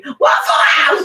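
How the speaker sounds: high, slow, thrilled cry of "Waffle House!"; overjoyed yell of "Waffle House!"; excited shout. Retold or imitated. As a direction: squeal it with delight, drawn out. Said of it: "I was so fucking happy".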